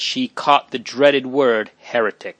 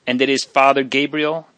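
ordinary delivery

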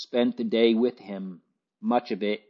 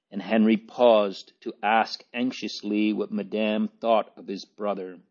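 plain reading voice

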